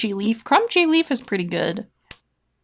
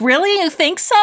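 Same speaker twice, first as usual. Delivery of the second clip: higher, surprised little voice; Gyro surprised by the praise, higher; voicing Gyro; 8 semitones higher, 3 dB louder than usual. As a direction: squeak the doubt up high. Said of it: "And Gyro's like".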